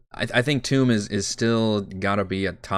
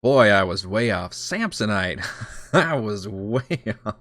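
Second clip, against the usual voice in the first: ordinary talk, nothing marked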